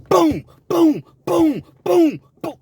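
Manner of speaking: loud, high, repeated booms; a string of loud mouth punch noises; rapid punch sounds. Retold or imitated. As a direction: pound out a flurry of hits. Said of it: "John hit him again".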